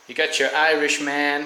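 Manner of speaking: a touch of American accent. Real, not acted. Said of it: "I said that with an American accent".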